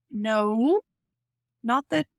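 usual speaking voice

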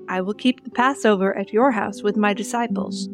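normal talking voice

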